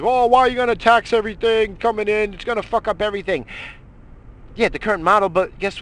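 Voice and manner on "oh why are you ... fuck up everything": higher, louder whiny voice aping the critics; mock the complainers with a higher whine. Ranter mocking tariff critics with a higher whiny voice. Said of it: "now people's like".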